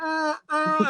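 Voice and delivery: high-pitched "ah, ah"; imitated goal cheer. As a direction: mimic a cheer as the goal goes in. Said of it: "'cause they go".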